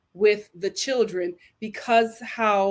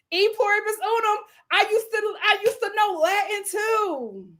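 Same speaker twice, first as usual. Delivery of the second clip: high boast; 10 semitones higher than usual. Real, not acted. Mock-grand bragging about Latin.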